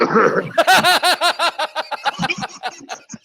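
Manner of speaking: long, high helpless giggle; giggling. Real, not acted. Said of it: "He's giggling like a little girl".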